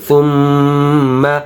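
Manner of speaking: সুম্মা with a long hummed ম; obligatory ghunnah. Shown as a demonstration. Teacher shows ghunnah, slow nasal ম in সুম্মা. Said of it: "এটা কি ওয়াজিব গুন্না".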